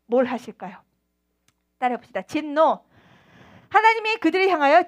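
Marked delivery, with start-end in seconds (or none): none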